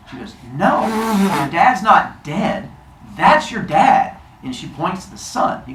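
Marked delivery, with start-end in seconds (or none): 0.5-4.2 higher, drawn-out "no"; slow, emphatic motherly correction; emphatic reassurance. Acted. Mother reassuring her son about his dad, emphatic.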